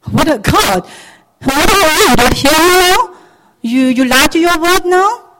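high, loud, accusing outcry; blaming God. Acted. Believer accusing God of not listening, angrily. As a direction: challenge God with indignant questions. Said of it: "we blame God for that".

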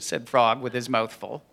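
lively storytime narration; animated children's story reading. A narrator's reading. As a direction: read it to children with big swoops. Reads a children's story aloud.

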